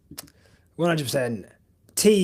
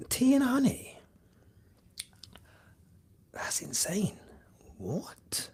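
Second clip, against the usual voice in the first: whisper; 5 semitones higher, 8 dB quieter than usual. Real, not acted. Whispering disbelief at chat.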